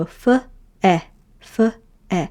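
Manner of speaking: f then eh, separated; teacher sounding out fe. Shown as a demonstration. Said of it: "And you go".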